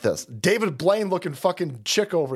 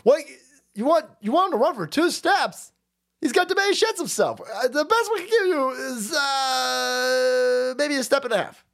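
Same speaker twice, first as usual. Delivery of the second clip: frantic shout; 8 semitones higher than usual. Acted. Staffer haggling over steps, high panicked shouting. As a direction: yell the bargain in panic.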